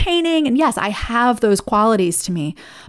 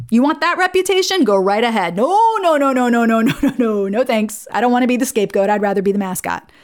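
playful refusal; 2 semitones higher than usual. Acted. Mascot turning down the scapegoat's job, playful refusal. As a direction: wave it away in a quick string of noes.

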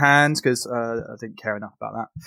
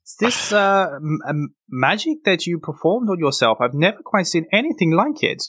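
higher, fussy healer voice; Vandy the priestess; 7 semitones higher than usual. Acted. Priestess to the concussed hero, higher fussy voice. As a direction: reassure the patient kindly.

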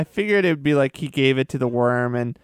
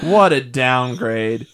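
ordinary talk, nothing marked

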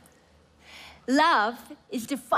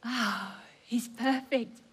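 normal talking voice

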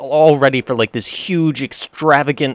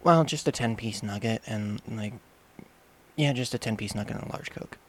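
meek small voice; 11 dB quieter than usual. Retold or imitated. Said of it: "And she's like".

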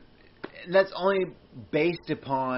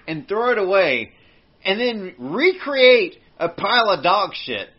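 ordinary talk

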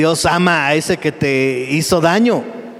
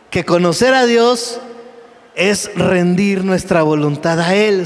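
normal talking voice

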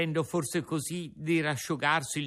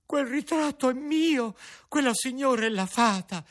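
much higher, excited Pinocchio voice; puppet's inner voice; 10 semitones higher, 4 dB louder than usual. Acted. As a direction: gasp of recognition in a puppet voice. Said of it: "disse dentro di sé Pinocchio".

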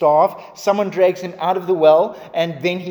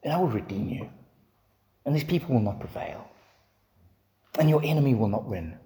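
much softer, calm reassuring promise; gentle divine promise; 5 semitones lower, 6 dB quieter than usual. Acted. God promising Jeremiah, soft and reassuring. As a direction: speak it like a quiet vow. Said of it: "And yet God's going".

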